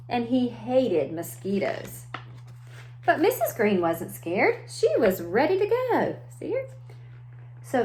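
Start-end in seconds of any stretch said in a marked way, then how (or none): none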